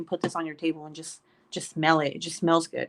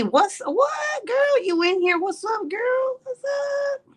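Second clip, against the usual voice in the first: squealed greeting; much slower than usual. Real, not acted. Greeting a friend in chat, high and squealing. Greeting a viewer named Christy.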